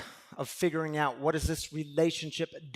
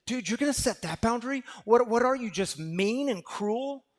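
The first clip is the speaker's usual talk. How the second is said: loud, higher mocking inner critic; 7 semitones higher, 4 dB louder than usual. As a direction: scoff at the listener as their inner critic. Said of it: "It'll say".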